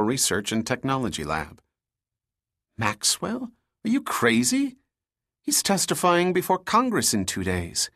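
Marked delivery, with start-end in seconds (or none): 2.8-7.9 high, incredulous outburst; shocked disbelief. A narrator's reading. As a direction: react as if she's lost her mind. Bob alarmed at Lisa's idea.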